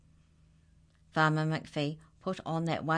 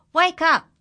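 much higher, loud call; joyful shout; 13 semitones higher, 14 dB louder than usual. Acted. Farmer shouting to wake the animals, joyful. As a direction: rouse the whole farm. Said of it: "he shouted".

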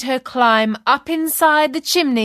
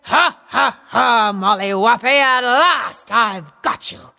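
giant voice. A narrator's reading. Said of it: "grabbed her by the arm and said".

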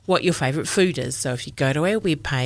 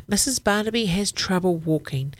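usual speaking voice

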